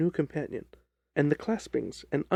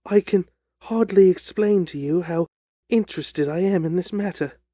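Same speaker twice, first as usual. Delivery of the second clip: higher, louder little fellow; eager outburst; 4 semitones higher, 7 dB louder than usual. Acted. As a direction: gush with nervous eagerness. Ryder eagerly thanks Holmes.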